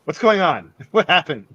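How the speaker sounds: loud, high startled questions; panicked confusion. Acted. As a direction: burst out in bewilderment, half laughing. Goblin pushes away in confusion.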